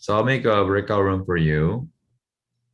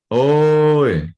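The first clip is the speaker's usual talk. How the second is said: 오이 drawn out slow, higher and louder; Gyeongsang call; 6 semitones higher, 6 dB louder than usual. Shown as a demonstration. Teacher leading the class in a Gyeongsang greeting, slow and loud. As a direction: stretch 오이 like a loud country hail. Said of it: "let's pronounce one by one all together".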